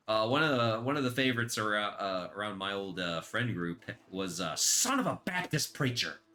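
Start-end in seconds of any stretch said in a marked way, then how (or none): 4.5-6.2 higher, exclaimed substitute swear; mock swearing. Quoting the old friend group's favorite swear, mock exclamation. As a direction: blurt it like a sudden curse.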